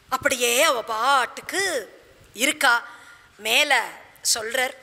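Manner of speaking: ordinary delivery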